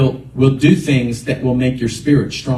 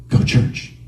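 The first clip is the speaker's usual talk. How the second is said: hushed, whispered nudge. Retold or imitated. Inner voice after a moment's silence, whispered. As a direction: whisper the command. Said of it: "like a whisper".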